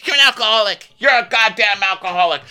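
high, loud mock shriek; hammed-up high-pitched outburst; acted accusation. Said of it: "and then goes".

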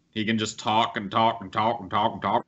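ordinary talk, nothing marked

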